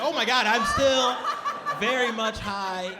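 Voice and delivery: high, loud dismay slowing down; mock horror dawning on him; shocked realisation. Cook realises he is still high, shocked.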